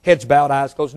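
usual speaking voice

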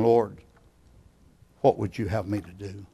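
low, soft question to God; hushed prayer. Acted. Preacher modelling what we ought to be saying, hushed and prayerful. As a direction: let it fall away very quietly. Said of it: "doing now is saying".

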